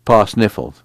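plain reading voice

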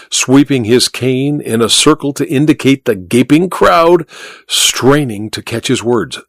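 plain reading voice

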